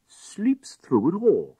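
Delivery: amazed drawl. A narrator's reading. Lord amazed at deaf lady.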